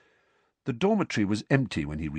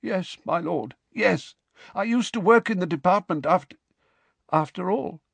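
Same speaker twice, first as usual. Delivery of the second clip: nervous servant; 9 semitones higher than usual. Acted. Cowering servant answers master.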